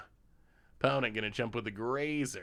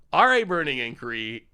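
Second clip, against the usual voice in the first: louder, emphatic card call; hyped-up announcement; louder repeat; 7 dB louder than usual. Shown as a demonstration. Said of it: "repeats louder".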